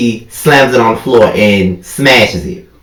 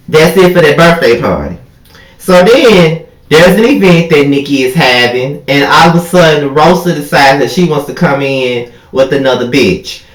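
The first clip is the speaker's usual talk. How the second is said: normal talking voice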